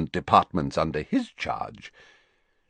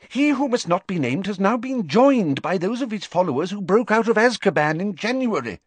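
high, urgent minister pleading his case; anxious wizard official, pitched high; Fudge's voice; 14 semitones higher than usual. Acted. Fudge tells the Prime Minister they're at war, high and urgent.

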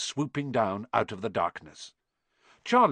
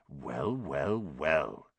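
lower, softer gloating whisper; 7 semitones lower, 7 dB quieter, much slower than usual. Acted. Filch catching Harry and Hermione on the stairs, gloating whisper. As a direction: savour the catch under your breath. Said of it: "he whispered".

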